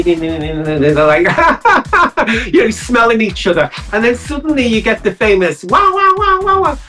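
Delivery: ordinary delivery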